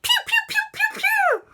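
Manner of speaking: squeaky, loud laser fire; laser zaps. Acted. Christopher fires at the ghost, high laser noises. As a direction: squeal out rapid shots.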